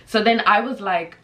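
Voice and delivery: ordinary talk, nothing marked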